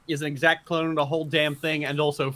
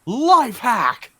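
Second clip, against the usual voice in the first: excited; 7 semitones higher, 7 dB louder than usual. Acted. Mock ad pitch catchphrase.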